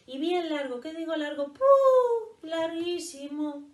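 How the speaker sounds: milk the surprise for laughs; exaggerated amazement.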